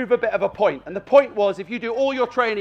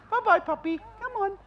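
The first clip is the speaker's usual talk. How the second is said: calling the puppy; 11 semitones higher, 6 dB quieter than usual. Real, not acted. Trainer calls puppy playfully.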